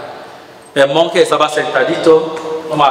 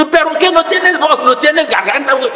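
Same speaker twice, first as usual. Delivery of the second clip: mocking shout; 12 semitones higher than usual. Man mocking the silent monk.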